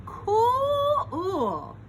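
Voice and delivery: high, loud swoon of cool; swooning awe. A narrator's reading. Narrator swooning at a jumping bean. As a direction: gush like a starstruck fan.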